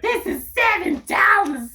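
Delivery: high, slow disbelieving cry; mock outraged fan. A fan handed seven dollars, high slow mock outrage. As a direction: play the stunned stranger. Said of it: "He's like".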